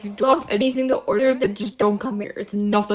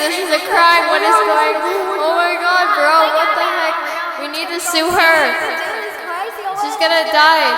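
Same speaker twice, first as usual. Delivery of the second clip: panicked outcry; 5 semitones higher, 11 dB louder than usual. Acted. Worker panics over stolen bird.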